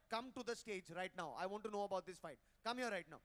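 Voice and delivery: ordinary delivery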